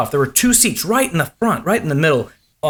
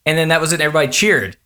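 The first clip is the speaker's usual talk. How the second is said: usual speaking voice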